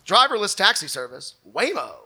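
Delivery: normal talking voice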